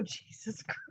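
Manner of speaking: whispered exasperation. Real, not acted. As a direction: breathe it out, exasperated. Aside exasperated under breath.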